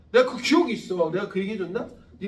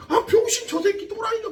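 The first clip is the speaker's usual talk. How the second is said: mocking classmates; 12 semitones higher, 4 dB louder than usual. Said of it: "막".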